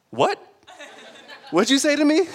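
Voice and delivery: high, loud outraged retort; indignant outrage. Pretend. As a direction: bristle like an offended parent. God snapping at sarcasm, imagined.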